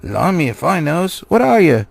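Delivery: troll interrogating the hobbit, loud and suspicious. A narrator's reading. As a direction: bark the question at the captive. Troll questioning captured hobbit.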